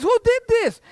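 high, loud accusing question; outraged dad. Acted. Dad demanding who smashed his god, outraged. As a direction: demand it at a shout, in acted outrage.

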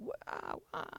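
breathy whispered amazement; whispered wow. Retold or imitated. Audience awed by the show, whispered wow. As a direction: whisper it in awe. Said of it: "these girls can go".

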